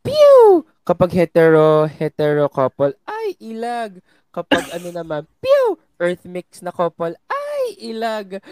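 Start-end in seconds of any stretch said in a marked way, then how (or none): 0.0-0.6 a sharp, high pew like a toy gun; gunshot noise. Pretend. Shipper acting out a shot at BL couples, high pew. 5.4-5.8 a sharp, high pew like a toy gun; gunshot noise. Pretend. Shipper acting out a shot at BL couples, high pew.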